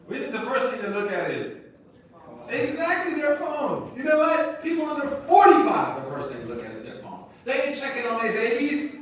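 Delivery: high and loud, in full preacher force; fiery preaching. Real, not acted. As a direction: drive it home to the crowd.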